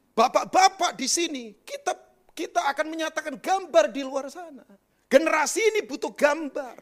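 high, loud, emphatic sermon voice; forceful preaching. Real, not acted. Preacher calling the congregation to be an image, forceful. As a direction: proclaim it to the whole congregation.

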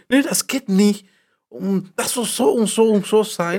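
loud, high, bossy imitation of her partner; mock bossy yell. Mocking his partner's strict tone, loud and high. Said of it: "Erstens, ich schreie nicht".

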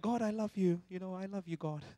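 dutiful murmur to God, no passion; half-hearted love. Retold or imitated. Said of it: "many times we're like".

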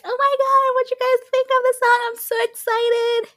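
high-pitched gushing fan; excited fan impression. Host imitating listeners eager for their opinion, high and excited. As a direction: squeal it out, mock over-excited. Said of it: "I know y'all are excited".

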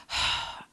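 short, soft exhaled sigh. Retold or imitated. Tired customer to bar server, imitated sigh. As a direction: let out an audible huff of breath. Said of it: "I kinda just sighed at her".

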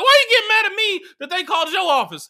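put-on high, loud whiny kid protest; indignant protest. Kid protests to mom about the school calling, high and outraged.

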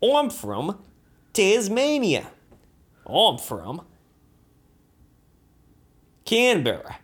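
swooping Australian, high on place names; Australian accent. Acted. Performer listing Australian hometowns, exaggerated Australian accent. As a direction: announce towns in hammed-up Australian. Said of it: "I'll try to do an Australian accent".

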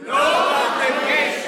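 congregation shouting the phrase back; called out big. Real, not acted. Crowd answering the pastor, shouted call-back. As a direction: say it with your chest. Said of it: "say it out loud, nice and big".

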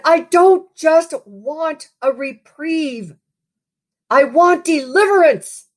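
high pleading yell, drawn out slowly; yelled plea. Retold or imitated. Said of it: "and I yelled out".

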